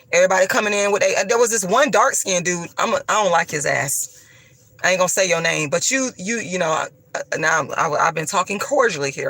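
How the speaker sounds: usual speaking voice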